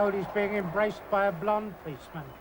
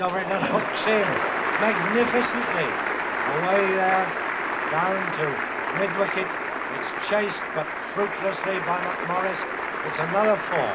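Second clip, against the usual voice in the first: louder, rising thrill as the ball races away; excited commentary; 6 dB louder than usual. Real, not acted. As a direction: call the boundary with mounting excitement. Dexter hooks a boundary, live call.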